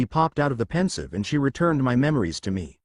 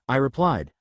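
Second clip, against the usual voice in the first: ordinary delivery, nothing marked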